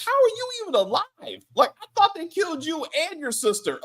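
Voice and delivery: high-pitched incredulous questioning; retold bafflement, voice pitched well up; baffled disbelief. Reacting to Alejandro turning up alive, baffled and high. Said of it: "I was confused".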